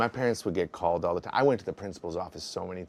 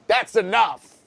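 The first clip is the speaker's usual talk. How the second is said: stern teacher; 11 semitones higher, 8 dB louder, slower than usual. Retold or imitated. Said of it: "my teacher is like".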